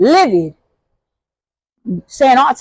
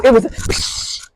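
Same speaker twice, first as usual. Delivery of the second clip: spray hiss sound; slower than usual. Shown as a demonstration. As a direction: hiss out a little spray noise. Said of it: "It wasn't like a boom".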